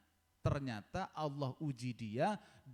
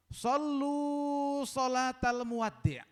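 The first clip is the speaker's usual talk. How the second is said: high, loud, drawn-out recitation; ringing sacred quotation; Arabic recitation; 8 semitones higher, 6 dB louder, much slower than usual. Acted. Reciting the Prophet's Arabic saying.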